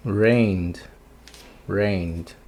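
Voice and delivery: rained in one syllable ending in D; -ed como D. Shown as a demonstration. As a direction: say rained with a bare D after N. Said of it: "o E D vai ter som de D". (rained com som de D no fim)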